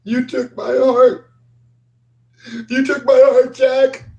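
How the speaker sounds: very high, slow, otherworldly ghost voice; haunt him from everywhere at once; ghostly echo. Acted. Ghost wife speaks to Jack.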